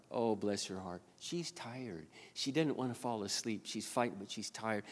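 put-on quiet murmur over a tired child; tender sympathy. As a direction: drop to a hushed, sympathetic thought. Tender realization about the crying toddler.

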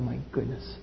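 hushed then rising anguished plea; a hint of grieving God; God's lament. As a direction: speak as a heartbroken father. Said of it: "God said to Himself".

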